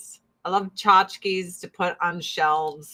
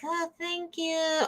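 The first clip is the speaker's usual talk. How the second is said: put-on higher, softer, slow sweet old lady; sweet grandma; 8 semitones higher, 7 dB quieter, slower than usual. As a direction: thank them gently like a kind grandmother. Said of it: "my grandma's, like, so sweet".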